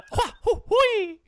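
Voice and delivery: very high squeaky hoo-hui; mock plumber jumping cries; Mario jump yells. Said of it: "That's a Mario joke".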